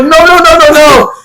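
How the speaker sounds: high, rapid-fire mock refusal; string the refusals together in a high, acted panic; panicked protest. Reliving protest on the floor.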